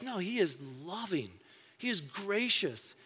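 ordinary talk

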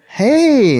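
high, loud nervous hey; nervous greeting. Retold or imitated. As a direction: blurt it out, too eager.

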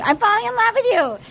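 high, quick burst of infatuation; excited squeal, imitating her; giddy mock confession. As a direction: blurt it out like a lovestruck contestant. Repeat of retold confession.

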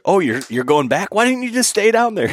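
high, fast, lightly voiced wife; imitating wife. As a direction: rattle off the wife's teasing question up high. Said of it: "she's like".

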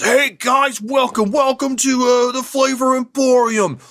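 very high, loud, eager restaurant greeter; booming high chef voice welcoming diners to his eatery; overexcited chef host. Acted. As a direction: gush at the guests like a hyped-up chef. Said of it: "he comes in and he says".

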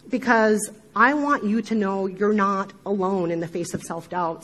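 usual speaking voice

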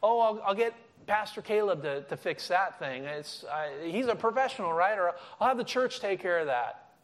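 lightly mocking lazy excuses; half-hearted whiny excuse; mock helpless shrug. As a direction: imitate the shrugging churchgoer. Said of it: "throw up our arms and go like".

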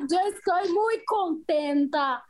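slow, lightly put-on mock Spanish; fake Spanish. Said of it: "faz um embromeixo aí, vai".

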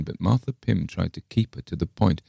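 plain reading voice

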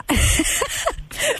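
shrill burst of laughter; high squealing laugh. Real, not acted. Laughs at the quote.

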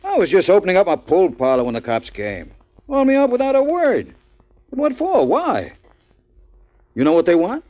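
a scared man begging for answers; nervous panic. Acted. Frightened man questioning a cellmate.

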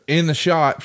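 ordinary delivery

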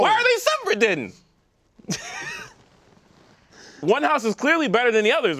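ordinary delivery

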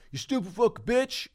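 hammed-up furious passenger; angry outburst. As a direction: fling the insult up high in fake rage. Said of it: "You can get angry".

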